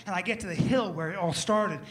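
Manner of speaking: normal talking voice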